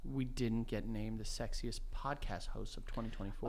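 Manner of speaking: very hushed, low stage whisper. Pretend. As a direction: murmur it low under your breath. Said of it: "stop whispering".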